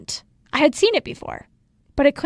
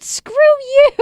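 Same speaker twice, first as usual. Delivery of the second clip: higher, louder mock yell of triumph; retold triumphant yell; 14 semitones higher, 9 dB louder than usual. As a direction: hurl it at the doctor, half laughing. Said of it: "I yelled out my doctor's name and I said".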